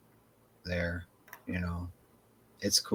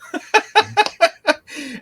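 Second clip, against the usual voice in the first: a big high burst of laughter; real high-pitched laugh; 8 dB louder than usual. Real, not acted. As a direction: laugh out loud, high and bright. Laughs at his own story.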